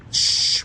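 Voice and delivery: voiceless, drawn-out "shh"; long shush. Real, not acted. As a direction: hiss a long shush at the dog. Shushing sound during dog training. (ششش طويلة)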